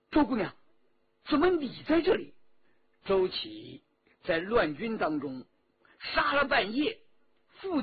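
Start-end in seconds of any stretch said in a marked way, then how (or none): 0.1-2.3 surprised, raised-voice call; 惊讶叫喊. Acted. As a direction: call out in astonishment, rising high and quicker at the end. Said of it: "徐天红叫道". (惊讶地高声喊)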